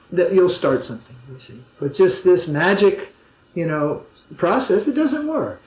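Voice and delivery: ordinary delivery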